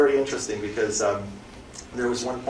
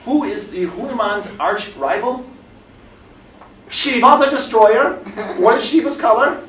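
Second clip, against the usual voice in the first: slow, rising, louder catechism; dramatic quizmaster; 8 semitones higher, 8 dB louder than usual. Retold or imitated. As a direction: build it up like a revelation. Manager building dramatic quiz.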